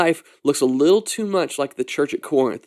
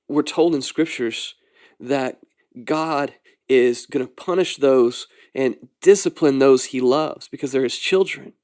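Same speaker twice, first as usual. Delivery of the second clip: normal talking voice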